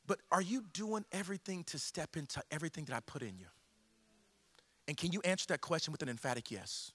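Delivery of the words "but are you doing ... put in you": soft, measured voice of God asking; hushed, probing appeal; God's gentle questioning. Acted. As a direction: drop low and quiet, pausing between phrases. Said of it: "God's saying".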